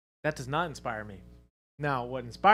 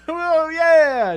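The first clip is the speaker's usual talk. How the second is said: excited; 17 dB louder than usual. Real, not acted. Excited about a new follower.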